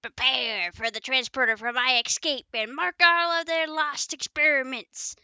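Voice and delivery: a hint of a scheming mad doctor; Doctor Cockroach voice. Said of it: "like, a Doctor Cockroach voice?".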